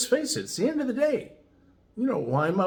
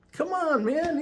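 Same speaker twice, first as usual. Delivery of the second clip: much higher, friendly protest; a lifted, easy come-on-man as Rick; imitating Rick; slower than usual. Rick waves off Eddie's excuse, imitated much higher. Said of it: "And he says".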